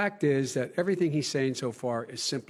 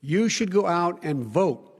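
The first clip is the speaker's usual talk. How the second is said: emphatic appeal; 5 dB louder than usual. Real, not acted. Candidate urging voters to vote, emphatic and raised. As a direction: urge it forcefully, stressing key words loudly.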